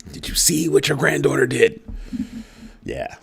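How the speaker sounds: ordinary talk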